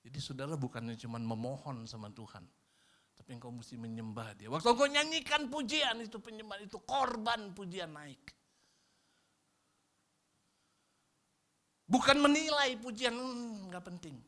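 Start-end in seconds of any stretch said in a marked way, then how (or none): 4.5-14.1 high, loud preaching; fiery preaching. Acted. Pastor urges the congregation to own mistakes, fiery and high. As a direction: drive the point home.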